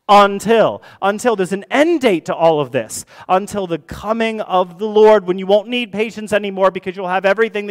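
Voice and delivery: high, loud, building sermon delivery; impassioned preaching. Real, not acted. Preacher promising an end date to suffering, impassioned. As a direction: drive the promise home at full pulpit force.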